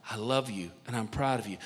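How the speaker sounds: low and soft; tender blessing. Acted. Father blessing his son, low soft tenderness. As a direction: drop down low for a quiet affirmation.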